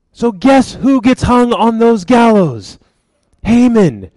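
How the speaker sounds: normal talking voice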